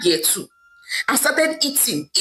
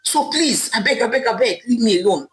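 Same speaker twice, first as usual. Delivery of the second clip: usual speaking voice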